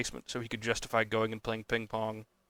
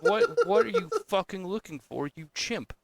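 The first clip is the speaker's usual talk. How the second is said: higher, louder incredulous outburst; fed-up, higher-pitched yelling at the auditors; exasperated rant; 10 semitones higher, 5 dB louder than usual. Real, not acted. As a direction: rail at the bureaucrats. Ranting at bureaucrats.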